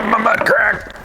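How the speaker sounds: high, loud yelps of pain. Real, not acted. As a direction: squeal as it hurts. Squeezing into seat, hurting.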